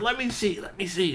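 high, eager begging for a turn; eager pleading. Acted. Curtis nudging his friend at the telescope, eager.

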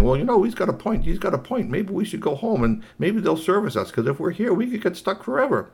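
high-pitched robot coming round; persuaded Wobble Rob. Acted. Robot worried about being stuck forever, urgent.

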